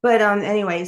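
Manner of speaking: usual speaking voice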